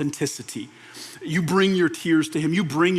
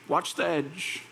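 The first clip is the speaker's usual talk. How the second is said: softer warning; 7 dB quieter, slower than usual. Shown as a demonstration.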